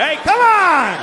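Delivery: hammed-up yelp at the guys; mock outraged yell. Mock outraged guy in kitchen.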